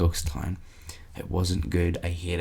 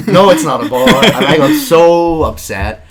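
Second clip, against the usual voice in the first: louder and higher, mock outrage; loud rant; 6 semitones higher, 10 dB louder than usual. Real, not acted. Host venting to co-host about fake bars, loud and upset. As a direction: vent it loudly.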